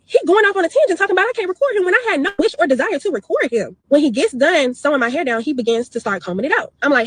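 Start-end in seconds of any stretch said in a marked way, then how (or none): none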